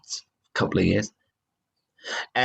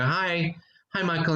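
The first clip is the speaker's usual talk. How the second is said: breezy greeting; 6 semitones higher than usual. Retold or imitated. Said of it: "walk into his room and go".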